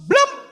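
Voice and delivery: high, sharp bloom for a leap; jump sound effect. Acted. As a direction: make the leap pop out. Sound of the frog jumping.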